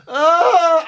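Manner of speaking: high crying groan; pained groan. Retold or imitated. Patient alone at night, crying groan. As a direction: let out a pained cry. Retelling agony in hospital bed.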